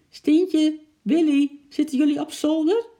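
high, loud questioning call. A narrator's reading. Sick father calls his children in the attic, loud and high. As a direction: shout up to the attic.

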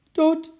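short, high, loud toot; toeter nadoen. A narrator's reading. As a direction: imitate one quick horn blast. Laatste korte toetertje. (kort, hoog toetertje)

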